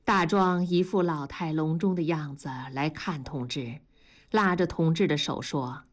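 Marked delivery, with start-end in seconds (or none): none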